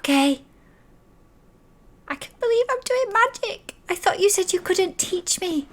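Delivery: put-on very high-pitched hushed child voice; thrilled child keeping it secret, pitched very high; whispering child. Child secretly messaging her magical friend, high and thrilled. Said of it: "Just whisper really, really quietly".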